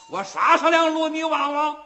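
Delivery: loud, high proud call; bold boast. Acted. Wu Song tells his sworn brother to watch, loud proud call. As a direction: announce the sword show proudly.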